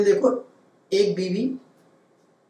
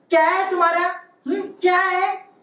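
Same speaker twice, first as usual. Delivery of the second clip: sassy maid voice; 10 semitones higher than usual. Acted. Maid answers Pappu mockingly.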